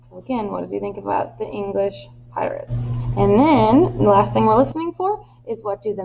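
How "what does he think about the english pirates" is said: plain reading voice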